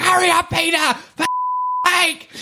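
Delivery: high-pitched mock shriek of impatience; imitated screaming wife. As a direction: scream and swear like the exasperated wife. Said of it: "she starts screaming and swearing".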